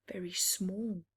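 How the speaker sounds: plain reading voice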